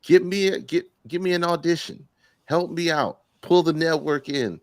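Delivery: high, hammed-up plea for a favour; mock pleading for work. Acts out asking Shaq for work.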